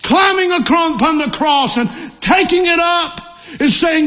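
high-pitched, emphatic pulpit delivery; fervent preaching. Real, not acted. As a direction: drive each clause home, pitched high, stretching the last words.

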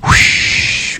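breathy rushing whoosh like wind. Shown as a demonstration. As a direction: blow it out like a gust. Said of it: "Everybody go".

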